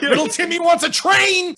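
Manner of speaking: hammed-up high, loud shouting; freaking-out Santa. Stressed Santa to his elves, mock frantic yelling. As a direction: yell like a stressed boss at his workers. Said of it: "he's freaking out".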